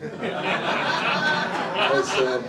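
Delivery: laughing. Real, not acted. Laughs before retelling reply.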